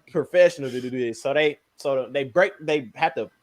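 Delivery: ordinary talk, nothing marked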